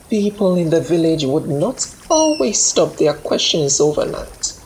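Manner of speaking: usual speaking voice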